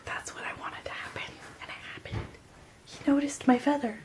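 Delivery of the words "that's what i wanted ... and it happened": secretive whisper. Retold or imitated. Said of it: "And I was like".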